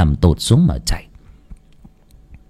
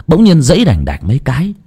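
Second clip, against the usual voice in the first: ordinary delivery, nothing marked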